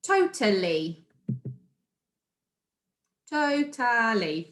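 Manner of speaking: slow "Totally", three separate beats, to-ta-lly; syllable by syllable. Shown as a demonstration. Teacher counting syllables for pupils, slow, segmented "totally". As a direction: stretch out each syllable of "totally". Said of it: "pronouncing the words clearly".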